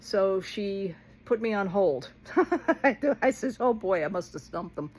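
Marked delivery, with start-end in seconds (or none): none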